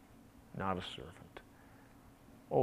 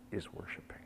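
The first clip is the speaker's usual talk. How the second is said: whispered in awe; hushed whisper; 12 dB quieter, faster than usual. Real, not acted. Preacher whispering to the congregation, hushed. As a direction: drop to a whisper on the word. Whispered climax of worship story.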